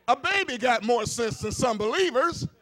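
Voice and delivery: loud, high preaching build; full-force preaching. Real, not acted. Preacher climbing high and loud to the congregation. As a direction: lift it up and drive it home to the church.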